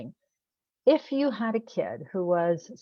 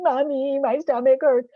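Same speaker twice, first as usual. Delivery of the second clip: put-on high, louder whiny kid wail; a child wailing for mommy, voice pitched up high; six-year-old impersonation; 7 semitones higher, 7 dB louder than usual. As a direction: play it as a sick little kid crying to mom. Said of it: "That's my six-year-old impersonation".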